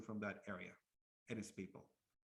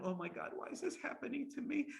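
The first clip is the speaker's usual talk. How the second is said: higher, complaining, self-pitying whine; whiny victim; 11 semitones higher than usual. Retold or imitated. Mocking pessimistic people who complain life is bad, in a high whine. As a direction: moan like everyone is against you. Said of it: "the most negative person".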